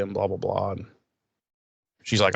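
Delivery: normal talking voice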